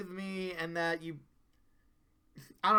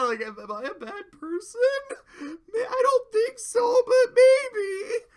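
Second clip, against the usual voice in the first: choked, much higher and louder half-crying laugh; 11 dB louder than usual. Real, not acted. Host moved by the memory of his dad, really crying. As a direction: voice breaking for real mid-sentence. Emotional after dad's story.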